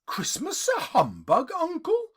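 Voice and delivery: rising high on Uncle; a playful, incredulous question; amused disbelief. Acted. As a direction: nephew teasing his grumpy uncle, rising high. Nephew surprised at uncle.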